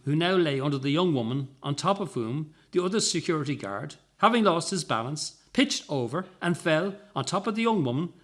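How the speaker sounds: usual speaking voice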